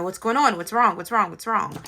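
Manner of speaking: loud, repeated urgent questions; urgent questioning. Retold or imitated. Mother to crying kids on the stairs, acted urgent questioning. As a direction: retell a worried parent's rapid questioning. Retold rushing to crying children.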